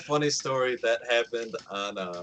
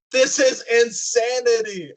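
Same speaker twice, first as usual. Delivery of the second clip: amazed; 6 semitones higher, 4 dB louder, slower than usual. Retold or imitated. Amazed by telepathic cat.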